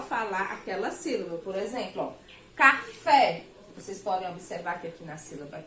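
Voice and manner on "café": "café" with a loud, higher-pitched stressed final "fé". Shown as a demonstration. Teacher showing the tonic syllable of "café", final "fé" stressed hard. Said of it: "eu pronunciei com mais intensidade".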